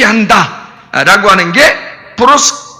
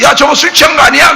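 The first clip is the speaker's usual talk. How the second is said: raised mocking call; mocking onlooker; 5 semitones higher, 3 dB louder than usual. Bystanders jeering at the Spirit-filled disciples, mock shout. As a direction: jeer at them like a bystander.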